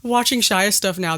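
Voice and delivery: ordinary delivery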